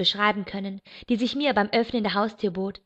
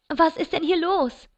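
raised voice over the noise, pitched up; calling out; 7 semitones higher than usual. A narrator's reading. Narrator at the door calls into the chaos, a little raised. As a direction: call out over the din, moderately. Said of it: "rief ich in den Lärm".

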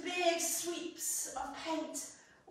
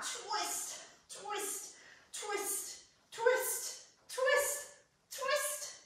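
each twist climbing higher; rising twists; 8 semitones higher, 3 dB quieter, much slower than usual. Shown as a demonstration. Teacher counting kids' twists, pitch climbing each time. Wringing out the flannel.

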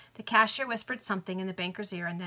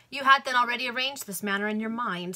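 mildly ironic, higher and louder; 4 semitones higher, 6 dB louder than usual. A narrator's reading. Banker to Greenwood on his prearranged scheme, ironic. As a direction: point it up with a touch of irony. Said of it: "said the banker ironically".

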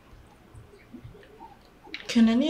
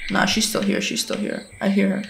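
hushed, unvoiced whisper; 4 semitones lower, 6 dB quieter than usual. Real, not acted. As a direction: whisper while hiding. Hiding from monster.

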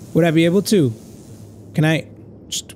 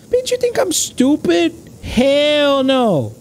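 much higher, indignant squawk; mock-offended high retort; outraged refusal; 11 semitones higher, much slower than usual. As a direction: scoff at the obvious trap. Refuses the plant's trick.

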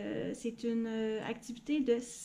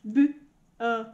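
robot voice; 7 dB louder than usual. Shown as a demonstration. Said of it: "c'est A".

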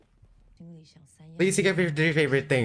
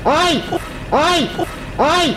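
frightened shriek; 11 semitones higher, 5 dB louder, much slower than usual. Real, not acted. Scared by horror video.

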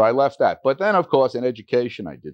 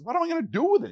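high, exasperated rhetorical question; exasperated doctor; 3 dB quieter, much faster than usual. Retold or imitated. Doctor brushing off counting his own cash, exasperated. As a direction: brush it aside like a busy doctor.

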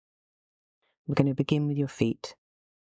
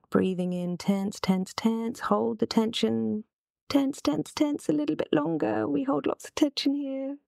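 sustained tension; 7 semitones higher than usual. Real, not acted. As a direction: pitch climbing far above usual and staying up. Coaching belly squeeze, held longer.

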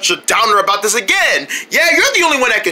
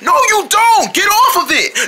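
high, urgent protest; alarmed command; 9 semitones higher than usual. Acted. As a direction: order a friend off in alarm. Fish orders puffin off the board.

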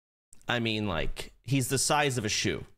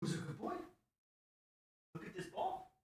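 puppy talk; 12 dB quieter than usual. Real, not acted. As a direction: breathe it sweetly to the puppy. Cooing to puppy.

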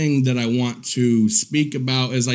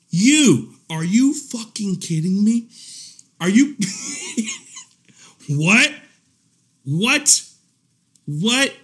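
higher-pitched, amused disbelief; incredulous outrage; 4 semitones higher than usual. Real, not acted. Answering the streamer who fat shamed him, incredulous outrage. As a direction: fire back in astonished, rising questions.

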